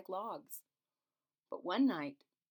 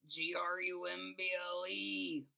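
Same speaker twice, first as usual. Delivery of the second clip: stomach grumble. A narrator's reading. The stomach grumbling, a lightly voiced rumble. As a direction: let the tummy rumble the word. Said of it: "grumbled his stomach".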